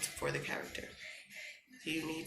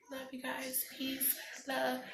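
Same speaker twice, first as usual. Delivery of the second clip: soft sign-off; 8 semitones higher, 4 dB quieter, slower than usual. Real, not acted.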